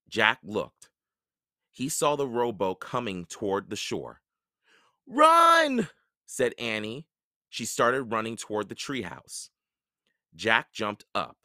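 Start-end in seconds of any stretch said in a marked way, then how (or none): 5.1-5.9 high, loud cry of alarm; urgent shout. A narrator's reading. Annie warns Jack as pirates approach, shouting. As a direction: shout the warning at the top of the range.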